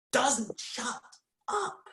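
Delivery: cracking up high in exasperation; exasperated squeak. Acted. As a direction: voice shoots up high and breaks into a cry. Exasperated rant about the boy.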